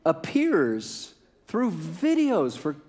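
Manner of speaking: high-pitched, drawn-out mock disbelief; comic exasperation. Preacher baffled by the Spirit appearing through videos, comic exasperation. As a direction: stretch the key words in baffled protest.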